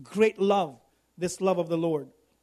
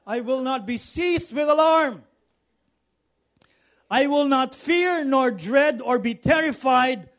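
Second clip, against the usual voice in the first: higher, shouted vow of no fear; raised shouted declaration of faith; bold shout; 4 semitones higher, 4 dB louder than usual. Acted. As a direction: cry it out fearlessly. Bold confession.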